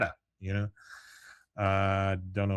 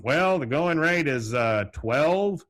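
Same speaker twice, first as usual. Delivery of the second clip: Dale improvising; 6 semitones higher, 6 dB louder, slower than usual. Retold or imitated. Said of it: "thinking on his feet".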